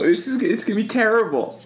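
high whiny giggle; can't stop laughing; laughing despair. Real, not acted.

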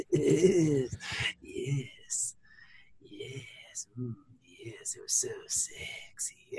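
breathy, husky whisper of yes; sultry whisper. Pretend. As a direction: whisper it seductively. Sultry whispered reaction to sexy episode.

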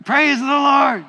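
shouted high and loud, Praise the Lord; loud congregation shout. Real, not acted. Congregation answers the preacher's count with a loud shout. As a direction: call it out with umph. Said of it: "do it with umph".

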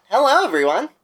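normal talking voice

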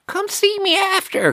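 very high, strained craggly geezer order; hammed-up screechy old-man summons; aggro old man. Old man at dump summons the customer, aggro high screech. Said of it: "he's aggro as fuck".

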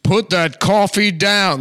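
high, loud, slow actor's command; Alec Baldwin. Acted. Comic as actor in Glengarry, loud commanding impersonation. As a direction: bellow it as a hammed-up movie tyrant. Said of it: "Alec Baldwin just being like".

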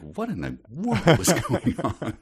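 high, slow, laughing bewilderment; baffled disbelief. Retold or imitated. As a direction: gasp out the confusion with a laugh. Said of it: "I'm like".

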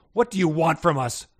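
mild growl. A narrator's reading. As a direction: demand it like a wary guard dog. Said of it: "Pongo growled".

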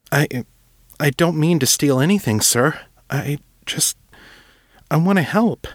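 put-on an earnest young writer begging to help; young Will, earnest.

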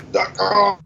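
choking on a drink; sputtering through a choke. Real, not acted. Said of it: "Sorry, choking".

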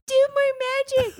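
high mock pleading voice; mocking friends. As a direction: beg like an eager fan. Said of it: "all my friends are like".

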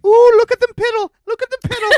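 high, gleeful little leprechaun voice. Retold or imitated. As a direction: squeal it like a giddy leprechaun. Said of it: "You sound like a little leprechaun".

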